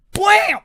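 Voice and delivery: very loud, high imitated slam; explosive impact noise. Recounting the treadmill hitting his shin, explosive impact noise. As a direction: blast it out like a sudden hit.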